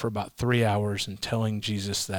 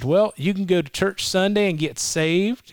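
lightly voiced old Southern counselor, pitched up; a hint of an old Southern gentleman, higher; 7 semitones higher than usual. Counselor to a troubled client, lightly voiced old Southern gentleman. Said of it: "he's a nice old Southern gentleman".

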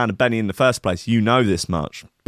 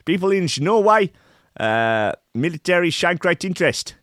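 mock official quote; 8 semitones higher than usual. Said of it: "Ree Wig said".

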